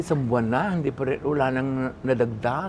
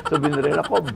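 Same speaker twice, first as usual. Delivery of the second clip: usual speaking voice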